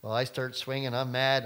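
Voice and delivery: ordinary delivery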